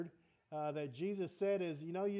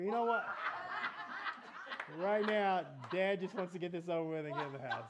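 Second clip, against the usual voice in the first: laughing retort; 3 dB louder than usual. Retold or imitated. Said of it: "And I go".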